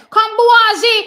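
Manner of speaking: loud high call to the runner; excited cheering. Real, not acted. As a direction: urge him on with everything.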